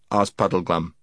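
plain reading voice

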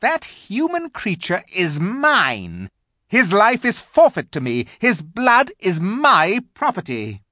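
high, loud, possessive witch. A narrator's reading. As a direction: stake your claim imperiously. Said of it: "continued the witch".

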